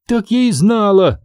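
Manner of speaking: high-pitched little fairy squeak; tiny creature piping up. A narrator's reading. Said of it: "пискнула фея-мотылёк".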